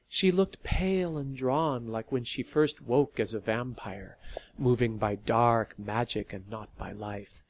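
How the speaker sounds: ordinary delivery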